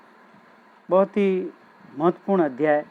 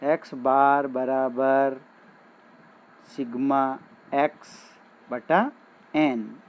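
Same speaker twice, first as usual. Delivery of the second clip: slow, deliberate reading of the formula; slow formula dictation; 3 semitones lower, slower than usual. Shown as a demonstration. Teacher slowly dictates the formula to students. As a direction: spell the formula out word by word so students can write it. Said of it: "ये सूत्र".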